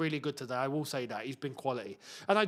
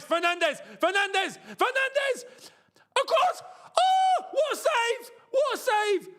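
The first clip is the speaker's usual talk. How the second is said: rising pitch, name called louder each time; building excitement; 4 dB louder than usual. Real, not acted. Fan watching the match calls out the player as the chance unfolds, building excitement. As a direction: call the name as the chance builds, then gasp at the save.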